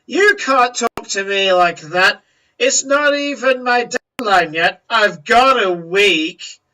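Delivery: furious protest over her deadline; indignant outburst. Acted. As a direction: protest hotly at the insult. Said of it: "She goes".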